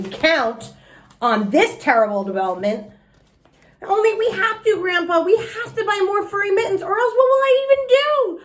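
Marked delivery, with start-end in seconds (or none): 3.8-8.4 high, pleading wail rising louder; desperate pleading child. A narrator's reading. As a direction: beg grandpa in a panic. Junie begs for mittens.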